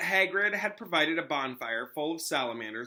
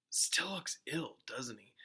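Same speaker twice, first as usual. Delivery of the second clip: slow, soft murmur about Lupin; worried hushed remark; hushed aside; 7 dB quieter, slower than usual. Acted. Ron murmuring about ill Lupin.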